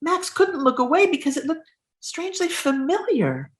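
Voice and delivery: expressive storybook narration, dramatic pauses; reading to children. Acted. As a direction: draw out the gross reveal. Reads picture book aloud.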